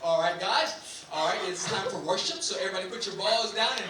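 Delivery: a hint of a laid-back camp pastor; mild mock pastor. Comic imitating the camp pastor calling kids to worship, lightly mocked. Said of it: "that's how they talk".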